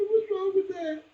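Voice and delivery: high, tearful question; boy crying, asking his mother. Retold or imitated. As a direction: ask through tears.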